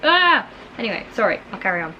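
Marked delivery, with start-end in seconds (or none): 0.0-0.5 startled cry. Real, not acted. Startled by rain.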